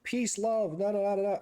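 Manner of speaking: high mocking singsong; mock the hippie mantra; sarcastic peace-love singsong. Mocking Jedi pacifism.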